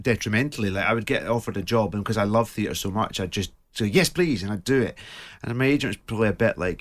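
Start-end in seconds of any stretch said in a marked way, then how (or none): 3.9-4.4 higher-pitched, keen retold yes; mock-eager yes; eager acceptance. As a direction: jump at the offer. Eagerly accepting any theatre job offered.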